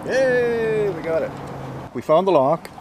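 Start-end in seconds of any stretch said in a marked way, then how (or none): none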